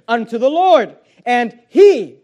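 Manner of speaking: "Lord" and "he" punched loud; stress on LORD and HE. Shown as a demonstration. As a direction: hit "Lord" and "he" hard. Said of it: "Notice again the emphasis".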